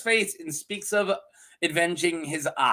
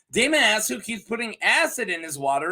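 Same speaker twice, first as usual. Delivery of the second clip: normal talking voice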